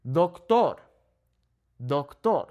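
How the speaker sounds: Doctor as dok-TOR, twice with a pause; Spanish "Doctor" with pure o vowels; Spanish pronunciation. Shown as a demonstration. Teacher models the word, Spanish Doctor. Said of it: "the natural emphasis on this word".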